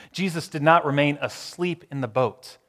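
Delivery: ordinary talk, nothing marked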